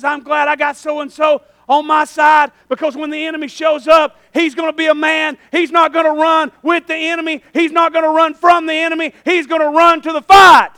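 loud, mounting pulpit delivery; full-force preaching. Real, not acted. God boasting of His man to the men, rousing preaching. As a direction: drive it up to a shouted finish.

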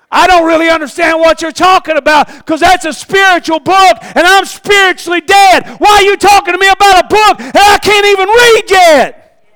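high, loud, bewildered protest; paralyzed man crying out at his well-meaning friends; exasperated sick man. Acted. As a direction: push back in disbelief.

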